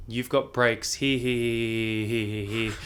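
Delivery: expressionless doctor listing injuries, settling into monotone; retold cold, matter-of-fact verdict; stone cold. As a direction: count them off without emotion. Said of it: "stone cold, he said".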